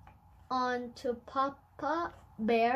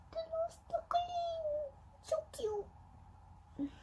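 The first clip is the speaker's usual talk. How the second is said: high, soft, airy coo over something tiny; 11 dB quieter, slower than usual. Real, not acted. Parent cooing softly over the little stick chair. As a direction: melt over the little figure.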